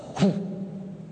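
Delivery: unvoiced dog huff; breathy huff. Preacher imitating the big dog huffing, unvoiced huff. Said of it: "Gertrude just huffs".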